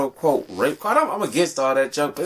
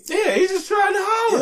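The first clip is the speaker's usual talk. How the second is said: excited shout. Real, not acted. Laughing agreement, raised.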